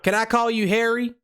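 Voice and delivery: put-on prank caller in a Southern accent. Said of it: "we're doing the Southern accent".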